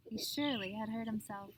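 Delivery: usual speaking voice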